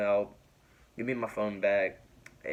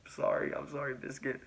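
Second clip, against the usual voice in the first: imitated giggling through an apology; half-voiceless, giggly mock apology; 4 semitones higher, 5 dB quieter than usual. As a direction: try to say sorry while breaking into giggles. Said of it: "like, giggling".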